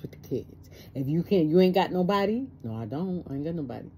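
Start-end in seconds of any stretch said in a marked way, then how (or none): none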